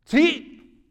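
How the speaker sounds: high, loud acted shout; one big burst of a word; calling out. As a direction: call it across a field. Acts reply called across field.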